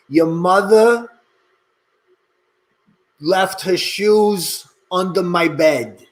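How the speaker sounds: slow New York dialect drill; coach's measured New York pronunciation; New York accent model. Shown as a demonstration. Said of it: "let's try a couple of exercises".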